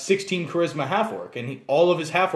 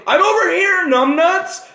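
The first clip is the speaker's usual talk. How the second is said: much higher, louder cheeky yell; taunting gnome; 14 semitones higher, 8 dB louder than usual. Acted. Gnome mocking the half-giant through Ghost Sound, high and loud. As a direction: bait the brute from far away. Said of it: "And you say".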